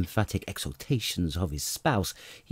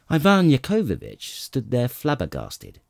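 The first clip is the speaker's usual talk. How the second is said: plain reading voice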